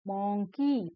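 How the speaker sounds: Monkey split into two beats, mon, kee; broken into syllables. Shown as a demonstration. Teacher showing children two broken words, syllable-by-syllable monkey. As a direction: break monkey after the first syllable. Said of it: "break करके बोला गया है".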